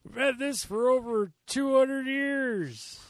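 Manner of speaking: slow high parody of a solemn keeper; mock solemn movie line. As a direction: drag it out like a grand reveal.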